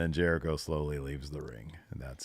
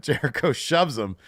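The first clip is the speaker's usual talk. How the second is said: usual speaking voice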